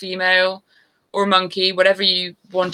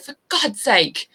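higher mock-exasperated husband; hammed-up grumble of giving in; 6 semitones higher than usual. Husband outdone on the dirt bike, mock exasperation. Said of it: "And he's like".

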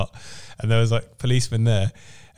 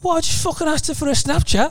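much higher, indignant squeak; high mock Scouse protest. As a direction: whine it out in outrage. Lad protesting to policeman.

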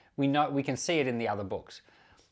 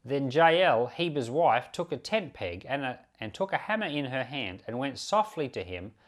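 steady, louder public reading of scripture; 2 semitones higher, 5 dB louder than usual. Acted. Teacher reading Judges aloud to the class, in a steady, louder scripture voice. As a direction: read the Bible passage out to the class.

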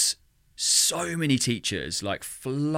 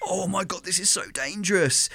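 high, alarmed gasp of disbelief; mock alarm; 8 semitones higher than usual. Host recalling her horror at the viewers' praise, alarmed and high. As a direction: sound horrified but half-joking. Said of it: "And I was like".

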